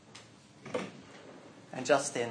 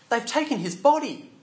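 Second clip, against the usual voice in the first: higher, louder, breathless news; Mary rushing in, higher and louder; alarmed news; 8 semitones higher, 6 dB louder than usual. Acted. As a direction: blurt it out in alarm.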